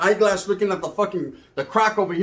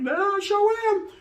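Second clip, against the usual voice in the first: much higher smug boast; smug high squeak; 13 semitones higher, slower than usual. Retold or imitated. Comedian answers the rival in a high smug squeak. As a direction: squeak it out, proud of himself.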